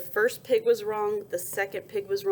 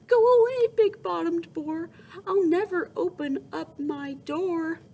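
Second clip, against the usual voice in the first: calling back; 9 semitones higher, 5 dB louder than usual. Acted. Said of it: "he called back".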